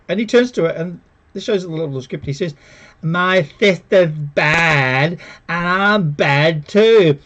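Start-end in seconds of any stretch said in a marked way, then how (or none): 3.0-7.2 slow, loud, laboured caricature; ponderous mocking line reading, loud; mock crude dialogue. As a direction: ham it up word by heavy word. Mocks the show's crude script.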